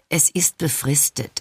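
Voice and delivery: slow, half-hearted explanation. A narrator's reading. Explaining the job to a hurt friend, half-hearted. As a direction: offer it slowly, without conviction. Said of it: "erkläre ich halbherzig".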